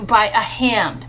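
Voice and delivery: ordinary delivery, nothing marked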